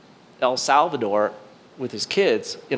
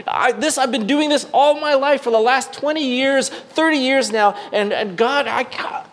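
higher, pleading protest to God; pleading believer; 9 semitones higher than usual. Retold or imitated. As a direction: plead like a believer making excuses. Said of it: "And you may say".

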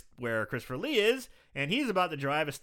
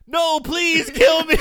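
high, loud, slow whiny plea; mock begging; 12 semitones higher, 11 dB louder, slower than usual. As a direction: beg for death, hammed-up. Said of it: "retelling the switch".

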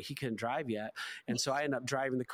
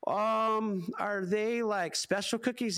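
a hesitant son, pitched up and curious; tentative higher voice; 8 semitones higher, slower than usual. Retold or imitated. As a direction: ask it sheepishly, a bit higher. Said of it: "And he goes".

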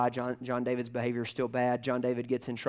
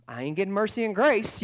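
boy's mock alarm; 7 semitones higher, 5 dB louder than usual. Boy realizing he'll be punished.

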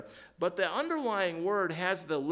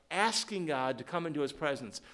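ordinary talk, nothing marked

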